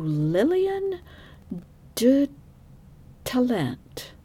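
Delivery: a hammed-up hint of French on the name; half-joking French accent on the name Lillian de Talent; playful French accent. Shown as a demonstration. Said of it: "That's my best French accent".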